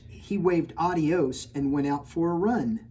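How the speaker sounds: rhyming picture-book read-aloud; story narration. A narrator's reading. Reading a rhyming storybook to children, lilting. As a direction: read the rhyme to the kids.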